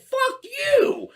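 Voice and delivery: very high, drawn-out angry comeback; road-rage shout. Retold or imitated. Driver cursing back through his window, high angry shout. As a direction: shout it back, pitched right up. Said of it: "I'm yelling at him".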